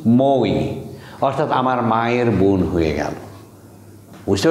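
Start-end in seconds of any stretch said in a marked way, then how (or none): none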